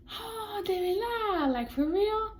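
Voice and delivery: high-pitched mock amazement; imitated surprised grandpa. Grandpa hears the granddaughter's news, mock surprise. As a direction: react in a put-on high voice, amazed.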